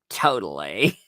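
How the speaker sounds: mock the hip-teen way of talking; mock teen affectation. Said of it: "She wants to talk like she's, um, hip with the, the teens".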